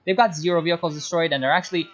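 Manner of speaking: ordinary talk, nothing marked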